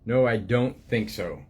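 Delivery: gentle storybook reading voice, unhurried; reading to children. Acted. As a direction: read it like a picture book to little ones. Reads book aloud to children.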